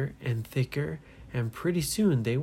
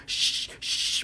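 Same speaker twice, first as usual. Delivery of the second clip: repeated breathy "shh, shh"; urgent shushing. Acted. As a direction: shush a noisy friend. Everybody hushes the yelling boy.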